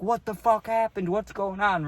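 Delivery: high-pitched imitation of an angry dad; mock-alarmed demand, pitched well up; angry father. Father questioning his bleeding child, high mock-angry demand. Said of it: "my angry father".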